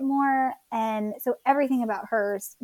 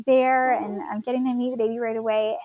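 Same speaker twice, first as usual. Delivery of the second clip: ordinary talk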